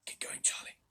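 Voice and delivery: soft urgent whisper. A narrator's reading. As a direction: breathe it low to Charlie. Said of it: "he whispered".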